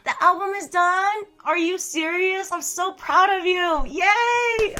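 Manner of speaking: high-pitched gushing, rising to a loud yay; real excitement. Real, not acted. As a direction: cheer a friend's big news with stretched, high delight. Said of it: "I sound sarcastic when I'm excited".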